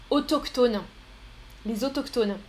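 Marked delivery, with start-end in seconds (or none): 0.1-0.8 autochtone said "o-tok-tone"; hard K for CH in autochtone. Shown as a demonstration. Teacher explains CH, autochtone with a K sound. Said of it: "on prononce que".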